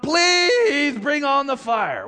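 high drawn-out begging; mock desperate plea. Priests of Baal begging their god, desperate high plea. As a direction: stretch out the plea, high-pitched.

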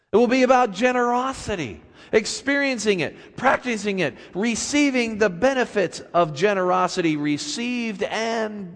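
fervent sermon voice; preaching. Acted. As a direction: preach it with conviction, then settle. Preacher building the grace theme.

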